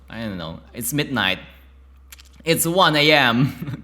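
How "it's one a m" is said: loud, higher-pitched, drawn-out exclamation; exclaiming. Real, not acted. Reader notices the late hour mid-article, loud exclamation. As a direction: stretch it out loudly in dismay.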